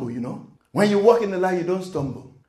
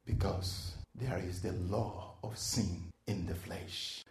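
soft prayer; 5 semitones lower, 10 dB quieter than usual. Acted. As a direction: bring the room into quiet prayer. Prayer-like closing, much softer.

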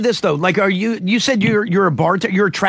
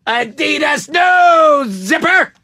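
vendor shout; 9 semitones higher, 4 dB louder, slower than usual. Retold or imitated. Hawking knockoff apparel, laughing.

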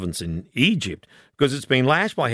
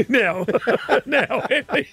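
high, giggly attempt to restart; laughing; 10 semitones higher, slower than usual. Real, not acted. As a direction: try to carry on through laughter. Host cracks up restarting the game.

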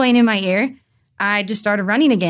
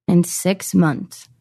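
sad; 4 semitones lower, much slower than usual. Retold or imitated. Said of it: "he got all depressed and all sad".

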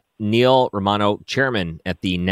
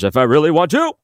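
a hint of rising, raised-voice politician impression; Bernie Sanders; 8 semitones higher, 6 dB louder than usual. Shown as a demonstration. Host giving a quick preview of his impression, lightly done rising voice. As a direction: slip lightly into the senator, pushing up at the end. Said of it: "Just a quick preview of it".

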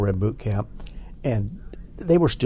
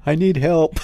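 louder, higher joking cry for rescue; comic distress, voice raised; mock plea for help; 7 semitones higher, 5 dB louder than usual. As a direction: call out for help between laughs. Imagined plea through the fence.

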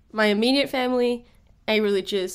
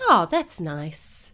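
bored politeness; 4 semitones lower, much slower than usual. Retold or imitated. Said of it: "We're just like".

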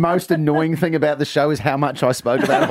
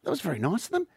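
much higher, sweetly surprised wife; wife suddenly won over by the excuse; appeased wife; 10 semitones higher than usual. Acted. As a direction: melt into gushing relief. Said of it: "And she goes".

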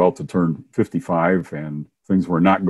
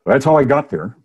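ordinary talk

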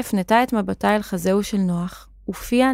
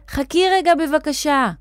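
high-pitched call to wait; restrained storybook shout; 7 semitones higher than usual. A narrator's reading. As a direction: shout after someone, without full volume. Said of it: "הוא צעק".

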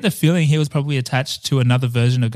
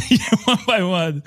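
laughing; 6 semitones higher than usual. Real, not acted. Amused at the dark plot.

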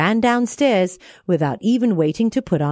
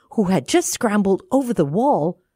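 plain reading voice

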